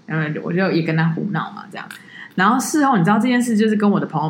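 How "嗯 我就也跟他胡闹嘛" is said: normal talking voice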